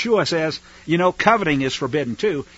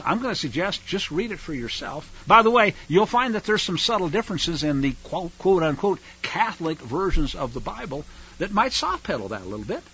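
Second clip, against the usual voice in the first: normal talking voice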